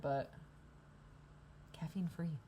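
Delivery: ordinary talk, nothing marked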